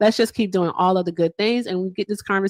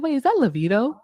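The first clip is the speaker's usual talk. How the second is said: higher-pitched replay of surprised recognition; retold surprise; 6 semitones higher than usual. Host retelling how they recognized the caller, mildly surprised. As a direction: mildly re-enact your own surprise. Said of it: "I was like".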